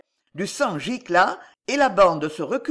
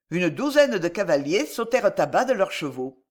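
usual speaking voice

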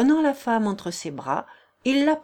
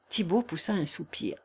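plain reading voice

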